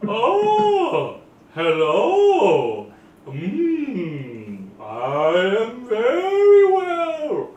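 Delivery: high, slow, dreamy creature voice; snail voice. Acted. Snail answers the druid's greeting, high and slow. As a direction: draw it out like a sleepy giant snail. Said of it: "is like".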